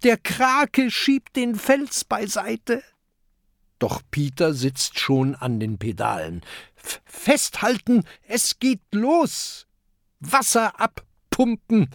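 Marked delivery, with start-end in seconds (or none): 0.0-2.8 high, slow cry of warning; high, drawn-out alarm; alarmed call. A narrator's reading. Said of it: "ruft Mathilda". 6.8-11.9 high, stammered command; excited high pirate calling to the crew; urgent orders. A narrator's reading. Pirate calls orders at the pedals.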